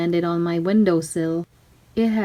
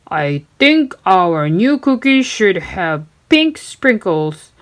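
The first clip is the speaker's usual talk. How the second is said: slow, louder gruff pirate voice; pirate captain; 4 dB louder, slower than usual. Acted. Captain explaining the cookie quarrel, slow pirate voice. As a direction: speak with pirate swagger. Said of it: "said the captain".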